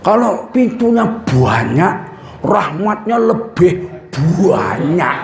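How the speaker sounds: put-on slow drawn-out Javanese accent with stretched "buanyak"; mock Javanese speaker, drawing out "buanyak". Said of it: "Itu kalau orang Jawa itu".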